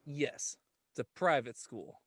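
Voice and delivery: ordinary talk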